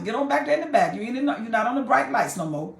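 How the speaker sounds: usual speaking voice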